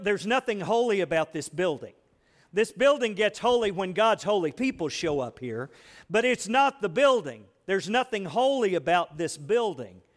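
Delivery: ordinary talk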